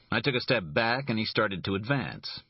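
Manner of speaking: plain reading voice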